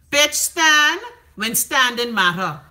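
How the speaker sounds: each word spaced out and hit; slow emphatic punchline. Acted. Host throwing shade at a politician, slow and emphatic.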